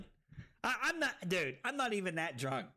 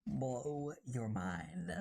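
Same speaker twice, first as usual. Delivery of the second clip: very slow, softer, drawn-out; slow hype-host echo; 4 semitones lower, 3 dB quieter, much slower than usual. Shown as a demonstration. Streamer mocking a tutorial intro, slow drawn-out tagline. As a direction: stretch it like a tutorial channel tagline. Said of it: "The fab tutorials that blow your mind".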